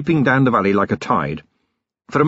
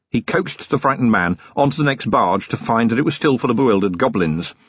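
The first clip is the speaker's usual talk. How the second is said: usual speaking voice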